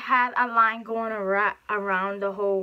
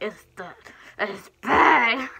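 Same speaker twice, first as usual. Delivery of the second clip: whiny fake cry, louder; mock whine; 7 dB louder, much slower than usual. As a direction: wail it like a kid. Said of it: "I'm gonna be like".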